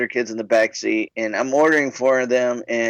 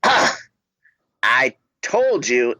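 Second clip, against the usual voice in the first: retold exasperation; slower than usual. Clerk to customer who keeps ordering, mock exasperated. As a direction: imitate a fed-up clerk, dragging it out.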